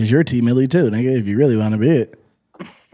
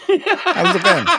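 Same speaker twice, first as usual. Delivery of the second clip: office guy greeting; much slower than usual. Retold or imitated.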